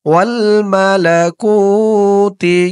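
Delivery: মালাকুতি with the কু held one alif long; long ku drawn out. Shown as a demonstration. Teacher shows the one-alif stretch on কু, slowly. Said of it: "কু এক আলিফ টান হবে".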